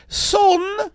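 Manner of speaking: very high falsetto mother; mother calling son. Acted. Mother summoning her young son, high mock-maternal call. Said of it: "my mother said".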